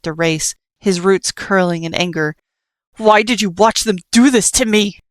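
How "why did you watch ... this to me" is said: angry demand. Acted. Nightmare confronts Frisk angrily.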